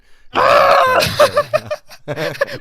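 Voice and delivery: very high, loud burst of laughter; shrieking laugh. Real, not acted. Bursts out at the German quote.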